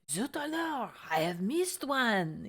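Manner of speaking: comic French cook, vowels stretched; French chef from The Little Mermaid. Retold or imitated. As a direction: exclaim in a hammed-up fake French accent. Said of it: "the French chef in The Little Mermaid".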